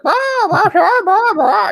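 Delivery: loud, very high rooster squawk; piercing cartoon hen squawk; high-pitched talking chicken. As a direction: squawk it like a rooster, laughter creeping in. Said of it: "like, in really high pitch".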